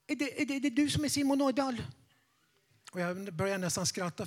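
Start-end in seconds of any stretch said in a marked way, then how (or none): 0.1-1.8 put-on higher, fast, stumbling old lady; an elderly woman at the door, rushing her words; nervous old lady. Nervous lady asking the preacher who he is, rushed and higher. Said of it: "För hon pratade lite för fort".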